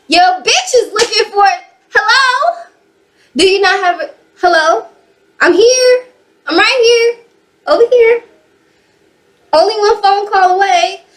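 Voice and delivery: high, sarcastic "hello, I'm right here"; sassy mock call for attention, pitched high. Mock calling out to him.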